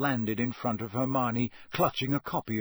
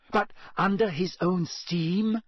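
eager; 6 semitones higher, slower than usual. A narrator's reading. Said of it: "said Hermione eagerly".

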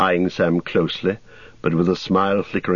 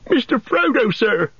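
much higher, pleading cry; frightened Sam. A narrator's reading. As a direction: beg for help, panicked. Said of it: "cried Sam, quaking".

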